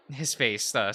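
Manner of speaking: ordinary talk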